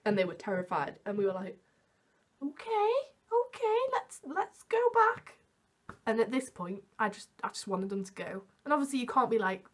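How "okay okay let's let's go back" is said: high, slow, hesitant agreement; humouring. Retold or imitated. Two girls agreeing to turn back for scared friends, high-pitched humouring. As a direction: go along with them in a high, drawn-out voice.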